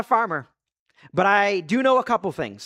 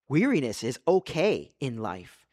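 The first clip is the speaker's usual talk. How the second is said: normal talking voice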